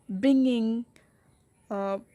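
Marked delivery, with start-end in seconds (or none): 0.1-0.8 ব্রিংইং with both syllables equally weighted; even weight on bring and ইং; equal stress. Shown as a demonstration. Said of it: "একটার চেয়ে আরেকটা কম stress হয় নাই".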